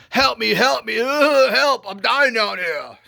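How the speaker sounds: high, loud mock cry for help; mock yelling for help. Husband stuck in a well, mock yelling for help. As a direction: call up from the bottom of a well.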